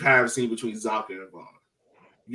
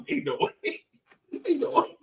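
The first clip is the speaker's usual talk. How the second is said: much higher, laughing disbelief; 4 dB quieter than usual. Retold or imitated. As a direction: squeal it like you can't believe it. Retelling disbelief at extra time.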